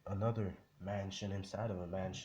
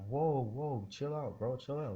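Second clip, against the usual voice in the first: mock alarmed calming; 6 semitones higher than usual. Everybody tries to calm him down.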